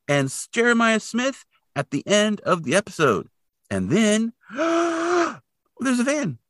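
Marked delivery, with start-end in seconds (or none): 4.5-5.3 sharp high theatrical gasp; hammed-up dramatic gasp; mock gasp. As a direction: gasp in fake suspense. Mock-dramatic gasp before the van scene.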